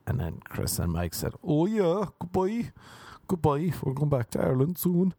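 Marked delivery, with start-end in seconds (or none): 1.4-5.1 higher, hammed-up Irish farewell; Irish accent. Friends in the back seat say goodbye, mock Irish accent. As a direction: wave goodbye in a put-on Irish lilt. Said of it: "Doing their Irish accents".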